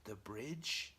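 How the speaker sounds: slow, soft, halting question; pondering. Acted. Norman recalling the girl and the bridge, softly pondering. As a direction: puzzle it out slowly under your breath. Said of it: "he's just sitting there thinking".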